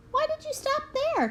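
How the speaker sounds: high, puzzled voice of the coach; coach's question. A narrator's reading. Swim coach asks the boy why he stopped, high and puzzled. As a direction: voice the swim coach asking, pitched well up.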